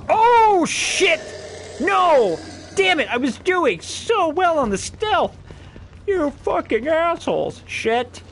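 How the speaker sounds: angry frustrated outburst; furious yell. Real, not acted. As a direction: rage at the enemies for ruining stealth. Stealth blown, raging.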